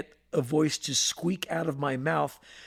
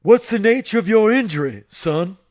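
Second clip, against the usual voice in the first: lightly voiced stern general; 4 semitones higher, 9 dB louder than usual. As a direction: boom it out like the school disciplinarian. General Price questions Jones.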